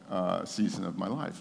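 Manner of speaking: ordinary delivery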